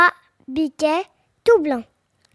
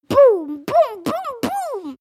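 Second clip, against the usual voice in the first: imitated heavy thuds, pitched high; booming knocks; 8 semitones higher, much slower than usual. A narrator's reading. Wolf battering the door, high booming sound effect. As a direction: boom out each blow on the door.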